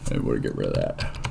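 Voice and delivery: talking through a yawn; words stretched by a yawn. Real, not acted. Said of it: "I'm yawning".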